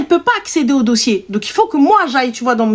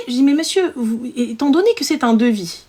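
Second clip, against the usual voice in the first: usual speaking voice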